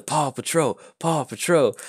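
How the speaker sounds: high-pitched mock crowd chant; imitated crowd yelling. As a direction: chant it like a cheering street crowd. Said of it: "they start yelling".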